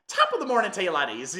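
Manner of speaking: loud, high-pitched cartoon Irish greeting; hammed-up Irish accent. Shown as a demonstration. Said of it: "doing an Irish accent, I didn't wanna be like".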